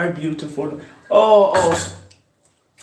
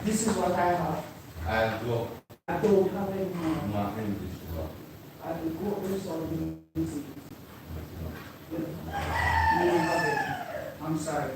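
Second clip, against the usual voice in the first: low, much softer side talk; hushed aside; 3 semitones lower, 12 dB quieter than usual. Real, not acted. Answering someone beside her off-mic, hushed. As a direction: keep it down, talking off to the side.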